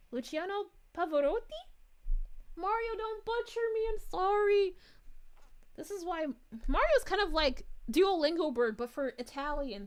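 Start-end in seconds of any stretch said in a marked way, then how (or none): 2.6-4.7 higher, louder playful apology; comic wail of apology; mock pleading. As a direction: beg Mario for mercy, hammed-up.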